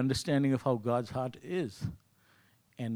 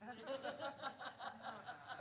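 high airy burst of laughter; breathy laugh; 19 dB quieter than usual. Real, not acted. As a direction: laugh it off at yourself. Laughing at his own story.

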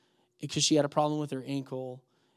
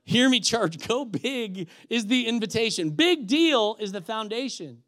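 higher, louder, slow preacher's declaration; emphatic preaching; 5 semitones higher, 6 dB louder, slower than usual. Real, not acted. Preacher driving home the point to church, emphatic. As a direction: proclaim it slowly, landing hard on key words.

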